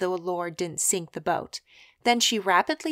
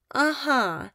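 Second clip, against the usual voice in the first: raised-pitch, accepting uh-huh; calling down acceptance; 6 semitones higher than usual. A narrator's reading. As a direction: call down a higher-pitched agreement. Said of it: "she called down in apparent acceptance".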